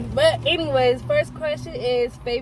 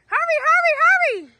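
high, loud hurry up; excited urging; 4 dB louder than usual. Real, not acted. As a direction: urge them on, pitched high.

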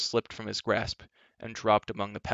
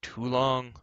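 moaning; 3 semitones higher than usual. A narrator's reading. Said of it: "moaned the voice of Glue".

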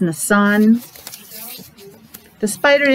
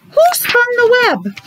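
much higher hooting owl voice; 14 semitones higher than usual. A narrator's reading. Reading to a child, the owl asks in a high voice. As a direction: ask it like a curious owl. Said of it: "said the owl".

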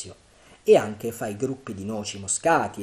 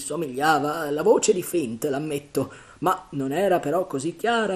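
high, loud, building to a cry on Ben Gunn; pirate rethinking whose voice it was; relieved sailor reasoning; 6 semitones higher, 8 dB louder than usual. Acted. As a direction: talk yourself out of the fear.

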